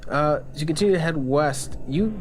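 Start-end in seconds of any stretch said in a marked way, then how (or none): none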